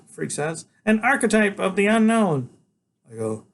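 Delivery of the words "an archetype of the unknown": put-on know-it-all boy. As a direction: lecture like a tiny professor. Freak voiced.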